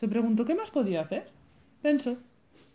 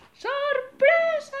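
much higher, drawn-out cheer; shouting surprise; 4 dB louder, much slower than usual. Acted. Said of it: "el monstruo gritaron". (grito de sorpresa)

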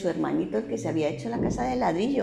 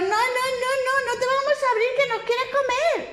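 very high piggy question; high piglets asking who's there; squeaky piglets; 3 dB louder than usual. A narrator's reading. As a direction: squeak it like tiny pigs. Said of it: "pero dijeron".

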